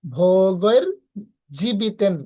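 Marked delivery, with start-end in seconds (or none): 0.0-0.8 ভোগের pressed out louder with a clear bho; ভোগের with full ঐকার. Shown as a demonstration. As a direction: stress ভোগের firmly. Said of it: "উচ্চারণ হবে কি".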